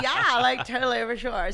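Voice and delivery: put-on upbeat Valley girl slang; hammed-up Valley girl agreement. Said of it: "You sound like a Valley girl".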